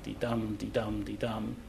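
"di-dum" murmured softly, weak then strong; soft iambic beat. Shown as a demonstration. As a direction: tap the iambs quietly. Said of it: "so-called iambic".

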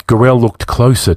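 usual speaking voice

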